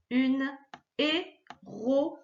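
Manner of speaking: "héroïne" slowly split into hé-ro-i-ne; syllable by syllable. Shown as a demonstration. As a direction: separate each sound of "héroïne", o apart from i. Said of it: "on entend O I N".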